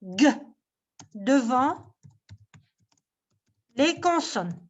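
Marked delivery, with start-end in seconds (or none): 0.0-0.3 "G" said as a hard "guh"; hard G sound. Shown as a demonstration. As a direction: say "G" as the sound guh, not the letter name. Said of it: "Elle se prononce".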